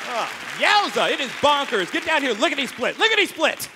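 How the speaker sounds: put-on high, loud, excited old-fashioned squeal; hammed-up nerdy caller. Comic voicing his own call to police, high excited alarm. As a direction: squeal the alarm like a flustered square. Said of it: "when you say lickety-split".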